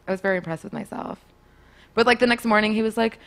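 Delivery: usual speaking voice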